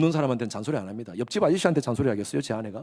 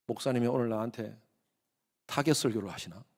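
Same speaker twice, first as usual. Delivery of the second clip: suspicious murmur; 6 semitones lower, 7 dB quieter than usual. Pretend. Voicing a suspicious churchgoer.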